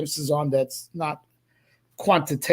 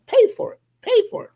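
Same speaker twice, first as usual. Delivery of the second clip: emphatic exclaim; 4 dB louder, faster than usual. Real, not acted.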